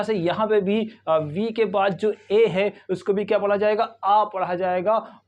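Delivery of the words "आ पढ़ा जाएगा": ordinary delivery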